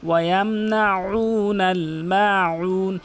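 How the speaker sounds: "মাউন" with ayn squeezed from the throat; very slow chant of "ইয়ামনাউনাল", throat pressed; pressed ayn recitation. Shown as a demonstration. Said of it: "হরফের মাঝখান থেকে চাপ দিয়ে". (আইনে চাপ দিয়ে ধীর তেলাওয়াত)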